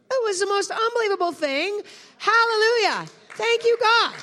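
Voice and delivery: loud, high preacher's shout; full-voiced hallelujah shout; exultant praise. Real, not acted. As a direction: cry out in jubilant thanks. Preacher exults over a conversion.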